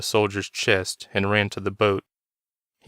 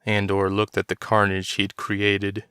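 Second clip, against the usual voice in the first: plain reading voice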